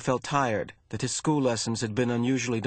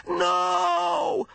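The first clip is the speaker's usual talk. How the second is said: long, very high cry of agony; anguished cry. A narrator's reading. Injured boy screams into empty snowy landscape, anguished cry. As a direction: cry out in pain, drawn out and high. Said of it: "he cried".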